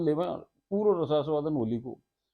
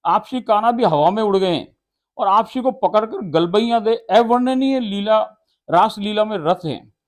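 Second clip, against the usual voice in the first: louder and higher, rapt telling; ecstatic devotional recitation; 3 semitones higher, 7 dB louder than usual. Acted. Preacher describing Radha dancing in the air, rapt and loud. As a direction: carry them up into the dance.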